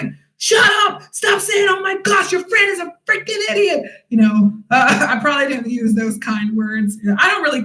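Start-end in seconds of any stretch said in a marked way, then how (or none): 0.4-3.9 high, sharp mock shout; retold angry snap. Telling the friends to shut up, angrily. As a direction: cut them off hard and high. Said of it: "I was mad".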